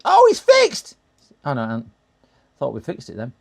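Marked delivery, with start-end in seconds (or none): 0.0-1.0 high, loud excited cry; real excitement. Real, not acted. Streamer thinking the sound fault is fixed, very excited. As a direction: burst out in delighted surprise. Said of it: "Get me all excited".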